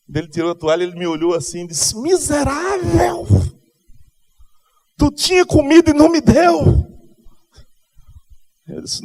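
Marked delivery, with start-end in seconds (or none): 2.0-6.5 much higher and louder, first word slow and dragged; hammed-up indignant friend; mock outrage. Hungry friend accusing Juninho of hiding food, mock outrage.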